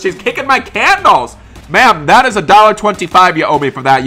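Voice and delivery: high, loud indignant protest; acted shopkeeper's outrage; outraged outcry. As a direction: call out the damage in outrage. Protesting at damaged candles.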